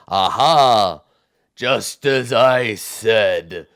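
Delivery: slow, drawn-out triumphant cyclops; ponderous gloating; triumphant giant. Acted.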